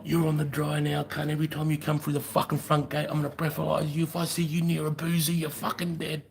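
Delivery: high, put-on raging sergeant-major voice; ballistic RSM. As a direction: rant like an enraged sergeant major. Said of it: "going ballistic at me".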